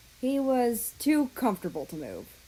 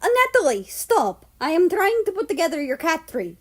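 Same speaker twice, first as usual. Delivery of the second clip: loud, high exasperated yell at the cat; 8 semitones higher, 8 dB louder than usual. Acted. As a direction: snap at the cat to stop, loudly. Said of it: "Russia felt bad for yelling".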